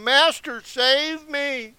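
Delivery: loud, high cry of "Master, save me". Acted. Sinking disciple calling to his master, a loud high cry. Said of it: "he cried out".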